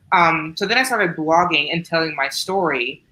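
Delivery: ordinary talk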